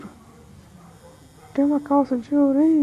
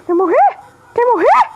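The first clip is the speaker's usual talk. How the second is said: cocky threat; 7 semitones higher, 3 dB louder than usual. Real, not acted. Threatens opponent.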